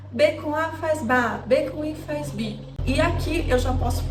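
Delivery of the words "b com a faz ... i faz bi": slow syllable drill, "ba", "bi" drawn out; sounding out words. Shown as a demonstration. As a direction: spell it out slowly like a teacher, "B com A faz ba". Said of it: "aquele tradicionalzão lá".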